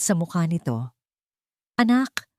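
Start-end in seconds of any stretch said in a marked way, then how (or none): 1.8-2.3 a hint of a concerned mom scolding gently; worried mother. A narrator's reading. Mother waiting up greets her daughter, lightly worried.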